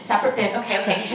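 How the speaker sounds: subdued, dropping echo; flustered compliance. Retold or imitated. Teen traveler scrambling at security, flustered echo. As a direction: mumble it back while obeying.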